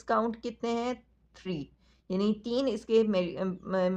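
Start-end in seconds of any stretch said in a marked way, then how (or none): none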